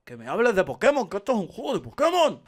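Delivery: fed-up shout. Real, not acted. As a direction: insist it's just a Pokémon game.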